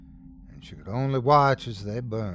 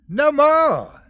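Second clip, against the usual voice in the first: high, loud enraged shout; mother at the end of her rope; furious shout; 7 dB louder than usual. A narrator's reading. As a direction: shout it down at a child, high and hard. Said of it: "Esme shouted".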